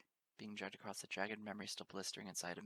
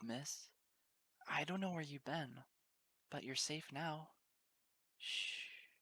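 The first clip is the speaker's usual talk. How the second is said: calm a frightened stranger with hushing; soothing hush; 6 semitones higher, slower than usual. Acted. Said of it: "murmuring breathy whispers".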